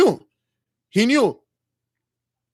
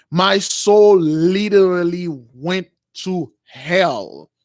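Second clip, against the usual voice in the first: very slow, drawn-out acted declaration; grave emphasis; much slower than usual. Moses telling his friend of his ordeal, grave emphasis. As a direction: stretch each word out with heavy weight. Retold solemn account of an ordeal.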